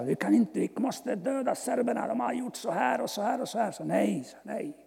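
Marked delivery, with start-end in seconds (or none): 0.0-3.7 a touch of heated, higher insistence; friends demanding revenge. Retold or imitated. Albanian friends insisting on killing the Serbs, mildly heated. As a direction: act out the friends pushing for revenge. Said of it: "mina albanervänner, sa".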